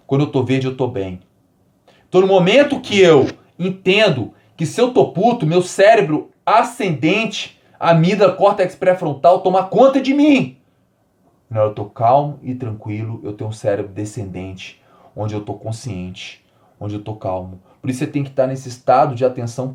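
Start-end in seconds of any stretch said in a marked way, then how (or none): 2.1-10.6 heated build-up. Pretend. Describes being furious, amygdala takes over. 11.5-17.6 calm. Shown as a demonstration. Contrasts calm brain with angry brain.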